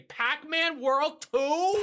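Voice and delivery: put-on Boston accent, "Two" drawn out slowly with a high rising pull; mocking Boston accent. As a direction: quote herself in a thick Boston accent, high and loud. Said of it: "he's making fun of because I say".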